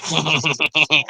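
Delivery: far-off cackling witch laugh; witch cackle. Acted. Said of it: "Yeah, that cackle".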